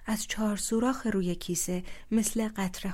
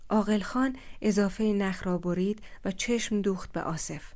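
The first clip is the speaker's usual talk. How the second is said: plain reading voice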